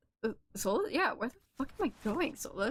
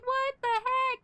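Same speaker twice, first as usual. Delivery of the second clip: mock bewildered; 3 dB louder than usual. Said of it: "the light's just like".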